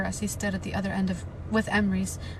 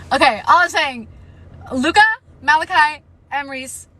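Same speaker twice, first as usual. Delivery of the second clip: loud, higher urgent call-out; yelling warning; 7 semitones higher, 11 dB louder than usual. Real, not acted. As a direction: shout the names at the characters. Warning the characters.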